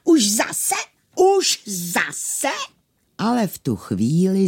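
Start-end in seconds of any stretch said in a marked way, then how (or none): 0.0-2.5 high, indignant squawk; shrieking. Acted. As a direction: squawk it like an outraged tailor. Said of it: "začal vřískat".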